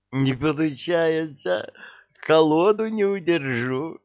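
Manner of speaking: high, helpless tipsy whine; пьяная девушка. Acted. Пьяная Розочка, высокий голос. (пьяный девичий голосок)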